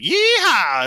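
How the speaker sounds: loud, high whooping yeehaw; hillbilly yeehaw. Retold or imitated. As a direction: whoop it like a rodeo cowboy. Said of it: "the trailers were like".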